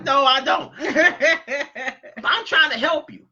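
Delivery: ordinary delivery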